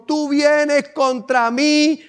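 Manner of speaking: slow defiant proclamation building to a shout; David defies Goliath, slow, rising to a shout; bold David. Acted. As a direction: declare it slow, then roar the last line. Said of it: "Lo miró y le dijo".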